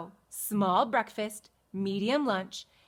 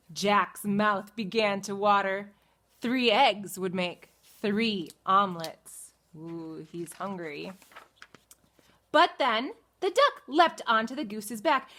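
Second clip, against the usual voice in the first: ordinary delivery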